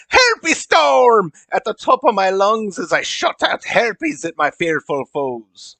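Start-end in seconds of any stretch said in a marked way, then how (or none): 0.1-1.3 high, loud elf war cry; screamed battle cry. Acted. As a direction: scream the attack name in accent.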